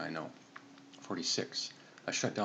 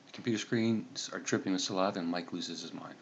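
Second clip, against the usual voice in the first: usual speaking voice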